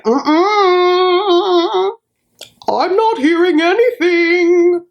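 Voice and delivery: high, drawn-out stubborn refusal; imitated high voice. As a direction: mimic a man's high voice, stretching it out. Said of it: "such a high voice".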